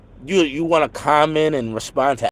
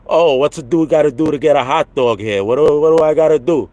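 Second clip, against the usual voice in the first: hammed-up Brooklyn Italian; deep Brooklyn Italian accent; 3 semitones higher, 5 dB louder than usual. Streamer shows his idea of a New Yorker, thick Brooklyn Italian accent. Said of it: "Italian accent from Brooklyn".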